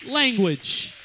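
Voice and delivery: loud preaching, rising to a shouted climax; full-force preaching. Acted. Preacher to his congregation, preaching loud at full force. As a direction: thunder it from the pulpit like a Pentecostal preacher.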